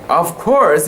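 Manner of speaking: ordinary delivery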